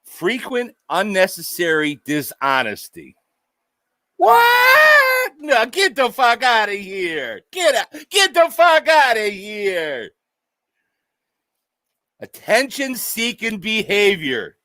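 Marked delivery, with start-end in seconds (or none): 4.2-5.3 a loud, very high what; mock shock. Reading the list, he yelps in mock shock. Reacts to the listed trait. 5.4-10.1 higher, hammed-up get out of here; mock disbelief. Reacting to the list, he yells in mock disbelief.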